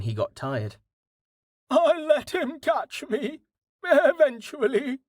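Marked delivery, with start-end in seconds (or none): 1.7-5.0 very high, slow horse voice; high-pitched animal character voice; smug horse. A narrator's reading. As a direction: think it aloud as a horse, high and leisurely. Horse Dust's smug thought.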